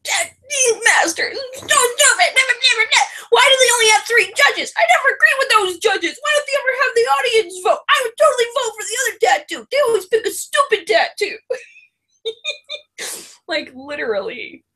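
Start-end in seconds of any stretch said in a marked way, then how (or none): 0.0-11.4 much higher, slowed pleading rant; outburst. Retold or imitated. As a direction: rage at the TV judges. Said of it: "another outburst of".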